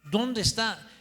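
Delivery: asombro recreado, fuerte y agudo; sorpresa imitada. Retold or imitated. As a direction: pregunta incrédulo, alzando mucho la voz. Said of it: "Yo me quedé sorprendido". (pregunta de asombro a voz alta)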